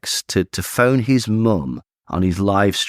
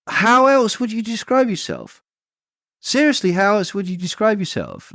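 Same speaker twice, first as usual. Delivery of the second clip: high-pitched, exasperated question; incredulous challenge; 10 semitones higher than usual. Acted. Challenging a man who denies being far right, incredulous. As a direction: press the question in a much higher voice. Challenging an absent man's denial.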